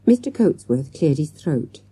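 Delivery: plain reading voice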